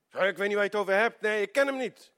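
fast, high, loud panicked denial; hurried denial. Retold or imitated. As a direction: brush it off in a rush. Peter denies a second time.

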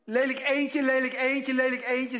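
high, loud, sneering singsong; mocking mother's taunt. Mother taunting her daughter, sneering loudly. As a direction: jeer it like a cruel mother. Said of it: "zei ze dan".

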